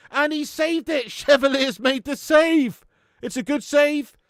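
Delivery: high, loud celebratory call; real joy at the keeper's save; excited shout. Real, not acted. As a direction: yell it out for the save. Keeper saves penalty live.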